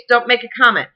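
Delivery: usual speaking voice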